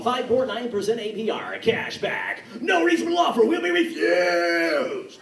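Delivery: loud, slow pushy car dealer shout; hard-sell it like a dealer on TV; obnoxious car ad. Retold or imitated. Car dealer yelling his ad at viewers, obnoxious and loud. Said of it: "obnoxious car commercials".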